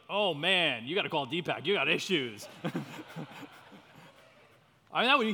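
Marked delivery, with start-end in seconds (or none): 0.1-2.5 loud, hammed-up brush-off; mock alarmed reaction. Churchgoer reacting to someone's confession, loud mock alarm. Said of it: "they'd be like". 2.5-4.1 breathy trailing laugh; amused laugh. Real, not acted. Speaker amused by his own mock reaction, breathy laughing.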